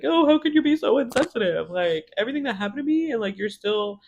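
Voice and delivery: high, wounded protest, imitated; mock a self-pitying complainer in a high-pitched voice; whiny Jer act. Recapper mimicking Jer feeling wronged, high and put-upon. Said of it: "Jer being Jer, it's like".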